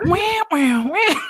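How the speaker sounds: loud, high imitated siren sting; mimicked revenge-film music cue; Kill Bill soundtrack wail. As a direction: belt out a piercing alarm-like "wah". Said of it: "waiting for the Kill Bill soundtrack".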